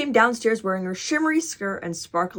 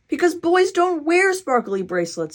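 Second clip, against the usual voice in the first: insistent big sister; 8 semitones higher, 3 dB louder than usual. A narrator's reading. Jessie objects to her brother.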